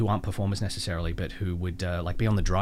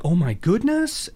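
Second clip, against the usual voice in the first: mock shock; 9 semitones higher, 5 dB louder, slower than usual. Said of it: "it'd be like".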